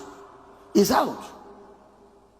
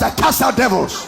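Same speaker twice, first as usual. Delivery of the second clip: slightly raised, forceful preacher's call; rallying shout; 3 semitones higher, 4 dB louder than usual. Shown as a demonstration. Preacher has the congregation declare it, rallying shout. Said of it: "Somebody shout".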